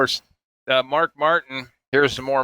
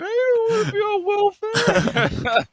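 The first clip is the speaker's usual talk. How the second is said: high, slow mock sob; mock crying wife who dreads welfare; imitating crying; slower than usual. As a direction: wail it like a tearful wife. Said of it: "your mom starts crying".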